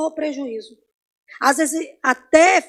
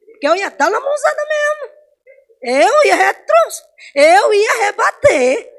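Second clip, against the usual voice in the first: much higher and louder, slow, crying out; heated telling; 7 semitones higher, 8 dB louder, slower than usual. Retold or imitated. Preacher reliving how she almost struck back, heated and slow. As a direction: drag out how close she came to hitting back.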